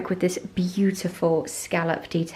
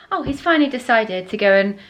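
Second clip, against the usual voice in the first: normal talking voice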